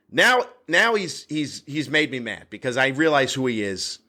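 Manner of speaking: ordinary delivery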